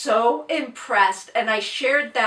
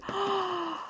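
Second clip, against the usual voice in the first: gasp; 8 semitones higher, 10 dB quieter than usual. Real, not acted. Unboxing a surprise gift.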